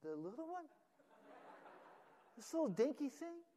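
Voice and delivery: put-on high, childish whining voice; mock child asking in dismay; disappointed kid. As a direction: play the let-down little boy. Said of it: "He said".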